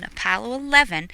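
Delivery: ordinary delivery, nothing marked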